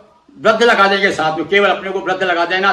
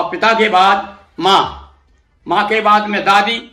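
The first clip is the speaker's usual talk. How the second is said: ordinary talk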